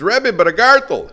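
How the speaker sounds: loud, high-pitched acted plea; distressed protest. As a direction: object loudly, pitch shooting up.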